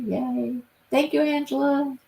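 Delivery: normal talking voice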